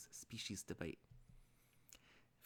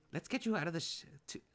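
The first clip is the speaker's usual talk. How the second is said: higher, louder fussing voice; worried friend; 7 semitones higher, 5 dB louder than usual. Acted. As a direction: fuss urgently over an exhausted friend.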